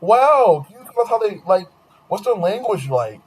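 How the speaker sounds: high, wide-eyed amazed voice; gullible classmates. Retold or imitated. Schoolmates swallowing the Wakanda lie, high and amazed. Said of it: "And they were like".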